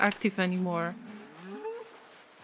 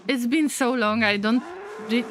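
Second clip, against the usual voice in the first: ordinary delivery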